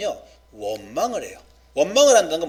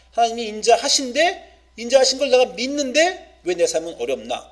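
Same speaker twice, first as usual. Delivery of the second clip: lament; 6 semitones higher, 4 dB louder than usual. Acted. Voicing a believer's lament.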